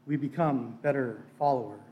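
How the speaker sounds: usual speaking voice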